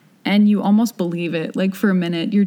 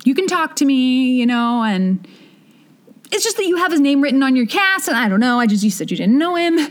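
concerned mom; 6 semitones higher than usual. Retold or imitated. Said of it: "she's like".

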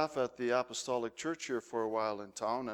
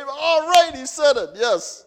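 much higher and louder burst of relief, easing down at the end; finally free, cheer it out; relieved cheer; 11 semitones higher, 11 dB louder than usual. Retold or imitated. Congregation cheering as the pastor finally says amen, loud relief. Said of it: "You know, they".

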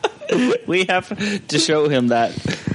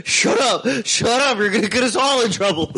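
yelling; 10 semitones higher than usual. Retold or imitated. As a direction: shout it, half laughing. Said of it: "Josh would say".

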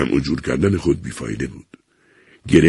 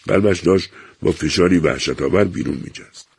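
usual speaking voice

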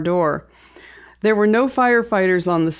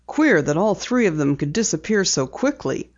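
ordinary delivery

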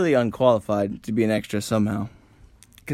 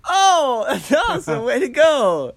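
much higher, louder cheerful reply; overexcited reply; 14 semitones higher, 6 dB louder, slower than usual. Pretend. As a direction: cheer like an oblivious extra. Imagined extras' reply.

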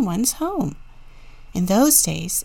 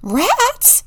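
very high, loud little bunny squeal; squeaky bunny surprise; excited squeal; 5 dB louder than usual. A narrator's reading. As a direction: squeal it as a delighted question. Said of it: "squealed the bunnies".

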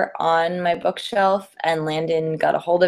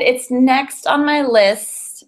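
higher, pleading excuses; mock guilty promise; 7 semitones higher, 3 dB louder, slower than usual. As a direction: beg off like a guilty borrower. Playing the guilty borrower.

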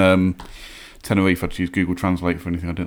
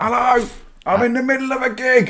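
very high, hushed phone voice; Trigger Happy TV caller. Retold or imitated. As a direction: answer the phone mid-gig in a silly high voice. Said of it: "Like Trigger Happy T V".

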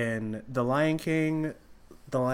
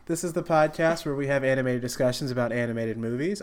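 podcast host intro; 5 semitones higher, 8 dB louder than usual. Acted.

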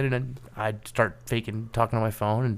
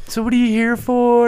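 high, louder nosy question; curious office lady; 11 semitones higher, 5 dB louder than usual. Retold or imitated. Lady at the next desk quizzing the intruder, high and bright. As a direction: ask brightly like a friendly coworker.

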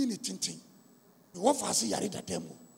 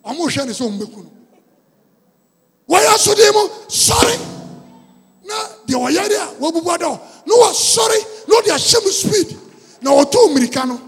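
high loud shouted preaching; full force preaching; 6 semitones higher, 12 dB louder than usual. Acted. Preacher retells commanding the sick young man to rise in Jesus' name, shouting.

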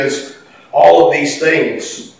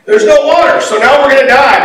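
high, loud mock panic; complaining outcry; 9 semitones higher, 6 dB louder than usual. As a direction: whine out loud as the grumbling crowd. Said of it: "they cry out and grumble and complain".

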